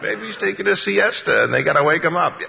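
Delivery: high, loud mocking suggestion; sarcastic taunt. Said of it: "sarcasm and cynicism in this".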